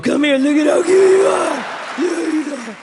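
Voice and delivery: high, gushing cutesy coo; baby talk. Pretend. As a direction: coo loudly as if scooping up a baby. Pretends to lift and coo at boyfriend.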